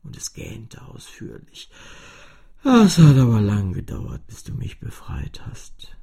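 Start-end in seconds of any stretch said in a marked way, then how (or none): 2.6-6.0 laut beginnend, dann geflüstert; Cellostimme. A narrator's reading. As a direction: erst vorwurfsvoll, dann leise verklingend. Cello spricht zu Fini. (Cellostimme, am Ende flüsternd)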